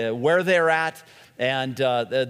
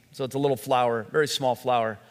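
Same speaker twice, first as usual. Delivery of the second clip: normal talking voice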